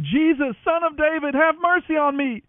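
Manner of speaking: high, loud desperate cry for mercy; shouting. A narrator's reading. Blind beggar calling out to Jesus, loud shout. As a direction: call out over the crowd, pleading. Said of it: "So he began shouting".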